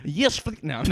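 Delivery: badly attempted Russian accent, breaking off; botched, Borat-like Russian accent. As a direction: have a laughing go at a Russian accent. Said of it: "Do it in the Russian accent".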